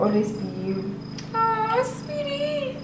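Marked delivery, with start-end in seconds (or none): 1.3-2.9 slow, much higher, choking up; tearful oh sweetie; moved to tears. Real, not acted. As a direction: let the voice crack with emotion. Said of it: "Why am I being emotional?".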